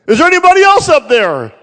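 high, loud shout of alarm; desperate shout. Retold or imitated. Bill on the branch yelling up the cliff, loud and panicky. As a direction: call up the cliff for anyone else.

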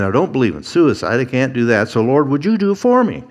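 mock desperate plea to the Lord; desperate Moses, rising. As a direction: Moses begging, pitch soaring at the end. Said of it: "Moses is basically saying".

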